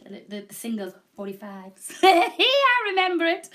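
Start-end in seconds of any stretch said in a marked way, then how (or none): 2.0-3.5 loud, slow laughing line. Real, not acted. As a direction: laugh it out at yourself. Laughing about her age.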